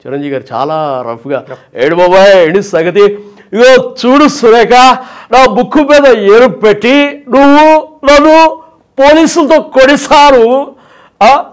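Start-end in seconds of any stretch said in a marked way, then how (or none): 1.8-11.4 put-on loud, slow slurred drunkard comedy; hammed-up drunk, louder and higher; Chiranjeevi drunk act. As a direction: play the tipsy hero complaining to Surekha. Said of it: "అంటే తాగుబోతే action".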